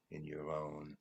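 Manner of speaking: trailing off into a whisper; fading to a whisper. A narrator's reading. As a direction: let the last word die on a breath. Poem's last words fading out.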